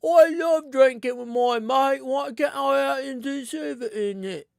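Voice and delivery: high, loud mock-indignant friend voice; mock outraged hermit. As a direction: squeal it like a hermit complaining about being left out. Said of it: "people over here being like".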